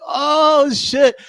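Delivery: excited, amazed fan reaction; surprised. Retold or imitated. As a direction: blurt it out, caught off guard. Reacting to Bayley's Japanese.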